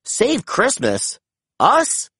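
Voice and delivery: slow stunned question rising to high disbelief; can't believe they'd be asked; amazed gasp. A narrator's reading. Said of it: "Ryder gasped".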